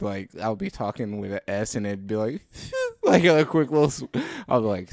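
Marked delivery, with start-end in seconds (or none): 2.5-3.0 short, high whistled S; imitated lisp whistle. Showing friends how an S whistles mid-speech, quick imitated whistle. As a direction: let a quick whistle slip out on an S. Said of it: "when I get a whistle out".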